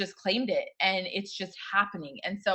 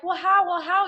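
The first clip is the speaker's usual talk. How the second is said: mock worried asking; 7 semitones higher, 6 dB louder than usual. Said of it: "sometimes we're like".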